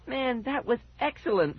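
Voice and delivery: high, delighted cheer. A narrator's reading. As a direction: celebrate a prank with a friend. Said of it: "Fergie cheered".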